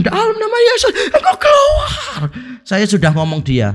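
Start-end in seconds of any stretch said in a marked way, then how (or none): 0.0-2.2 very high, strained mock shriek; escalated exorcist shout. Friend commanding the demon harder, imitated high-pitched shout. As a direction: cry the command out high, dragging the last word. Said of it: "tambah kencang".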